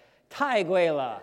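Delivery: panicked wrong phrase to the hotel employee; blurted Chinese. Retold or imitated. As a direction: blurt 太贵了 in a rush as the doors close. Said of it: "I say".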